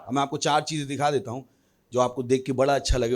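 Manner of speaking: usual speaking voice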